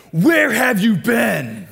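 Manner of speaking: slow, loud, high shout, leaning on where; angry dad shout. Retold or imitated. Pastor imitating his dad yelling at him for coming home late. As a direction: bellow it like a furious dad at the door. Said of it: "Usually, though, it's like".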